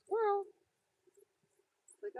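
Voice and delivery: high, loud burst of distraction; sudden distraction. Acted. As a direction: yelp it as if something just caught your eye.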